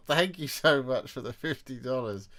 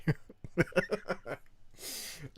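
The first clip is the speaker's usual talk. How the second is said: breathy laugh; 7 semitones higher than usual. Real, not acted. After giving up the stare.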